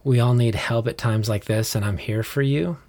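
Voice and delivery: normal talking voice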